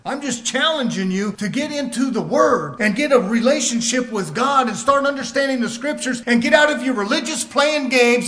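fired-up sermon delivery; full-force exhortation. Real, not acted. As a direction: challenge the congregation hard.

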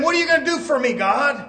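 high, pleading demand to God; demanding churchgoer. Pretend. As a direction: whine it up at heaven.

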